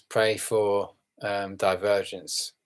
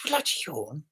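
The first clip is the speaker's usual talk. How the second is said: higher, softer imitated Chinese accent; 5 semitones higher, 5 dB quieter than usual. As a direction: lightly mimic a Chinese-accented old lady. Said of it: "Chinesey kind of like accent".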